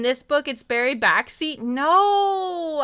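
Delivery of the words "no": high, louder, drawn-out no; protesting wail. Real, not acted. Streamer refusing the fan fiction idea, wailing protest. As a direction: wail your refusal playfully.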